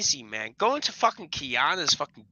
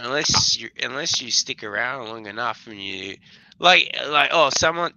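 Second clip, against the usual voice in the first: ordinary talk, nothing marked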